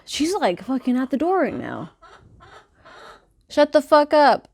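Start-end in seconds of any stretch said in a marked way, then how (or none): 3.5-4.5 high, loud exasperated shout; annoyed yell. Real, not acted. Yelling at Bertha banging at the door, exasperated shout. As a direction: snap at the screaming hen.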